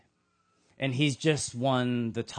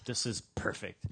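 smug murmur; 5 semitones lower, 7 dB quieter than usual. Retold or imitated. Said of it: "the religious leaders are like".

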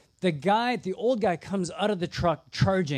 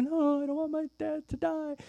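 put-on high, pleading young woman's voice; pleading bride; 10 semitones higher than usual. Bride begging about her father, high pleading voice. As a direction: beg as a frightened daughter. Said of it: "She's like".

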